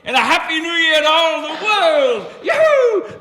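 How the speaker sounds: loud, high holiday cry; joyful shout. Acted. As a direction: call it out to the whole world. Scrooge shouts greetings.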